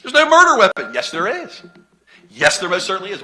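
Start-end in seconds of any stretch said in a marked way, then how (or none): 0.0-0.9 mocking counsel. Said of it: "We talked about that".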